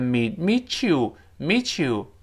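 "meet you" slow, t melting into ch; linked meet you. Shown as a demonstration. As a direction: run "meet you" together slowly as ch. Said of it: "这个meet you变成". ("meet you"慢读连读成ch音)

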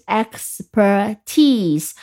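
"expertise" slowly, syllable by syllable, stressing TISE; stress on last syllable. Shown as a demonstration. Teacher showing learners the reading, "expertise" stressed on TISE. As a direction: draw out the final "tise" of "expertise". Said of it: "就把读音放在最后一个音节".

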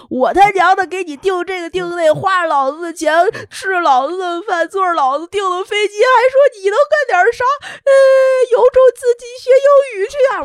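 imagined shouted rant at the husband; furious rant. Retold or imitated. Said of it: "我想说".

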